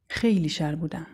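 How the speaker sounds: plain reading voice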